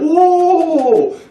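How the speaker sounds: very high, loud, drawn-out whoa; hammed-up awe of a watching crowd; crowd amazement. People seeing bread pop out, hammed-up amazement. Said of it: "people are like".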